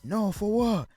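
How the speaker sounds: high-pitched stoned mock voice; J R Smith high voice. Narrator mocking J R Smith, high-pitched stoned voice. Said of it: "that's my J R Smith high voice".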